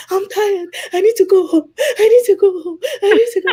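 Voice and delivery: high, loud wailed plea; hammed-up sobbing with laughter in it; mock crying. Exhausted traveler pleading with officials to go home, crying. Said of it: "I just started crying".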